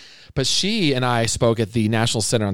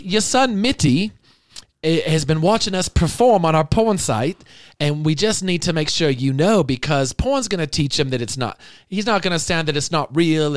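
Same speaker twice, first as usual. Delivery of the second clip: higher, louder mock accent, drifting British; put-on foreign accent; 7 semitones higher, 4 dB louder than usual.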